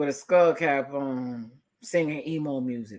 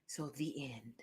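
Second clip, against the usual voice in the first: whispered aside; 5 semitones lower, 20 dB quieter, slower than usual. Real, not acted. Closing remark.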